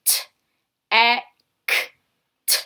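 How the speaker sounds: each sound said alone; sounding out letters. Shown as a demonstration. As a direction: split the syllable into its sounds. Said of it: "Let's stretch out that last clap".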